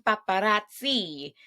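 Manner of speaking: hammed-up, badly faked Italian accent; failed Italian accent. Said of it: "at an Italian accent".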